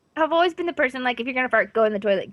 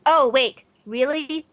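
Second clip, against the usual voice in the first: ordinary talk, nothing marked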